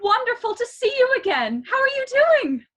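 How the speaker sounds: very high, loud princess gushing; acted breathless delight, high and loud; overjoyed greeting. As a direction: squeal hello to a long-missed friend. Elsa greets an old friend.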